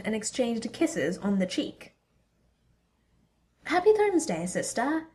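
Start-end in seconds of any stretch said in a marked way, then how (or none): 3.6-5.1 high, bright holiday greeting; bright cheerful. Acted. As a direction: sing out the greeting. Thor greets sister, bright.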